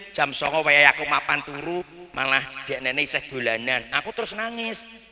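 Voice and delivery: ordinary delivery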